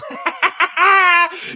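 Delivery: high, loud burst of laughter; gleeful laugh. Real, not acted. Commentator cracking up at a coach's technical, loud high cackle.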